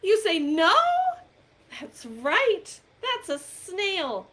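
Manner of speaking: big storytime voice, playful questions; reading to children. Acted. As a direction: coax little listeners to shout the answer. Interactive picture book for kids.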